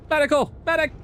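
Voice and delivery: urgent high yell for a medic; man down shout. Real, not acted. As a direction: shout for help across the map. Teammate down, calling for medic.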